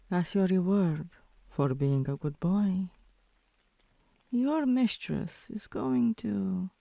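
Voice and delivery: ordinary talk, nothing marked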